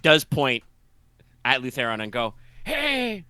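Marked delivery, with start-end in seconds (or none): 2.6-3.2 high, silly cultist shout; dopey cultist call. Acted. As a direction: call out like a dim goon.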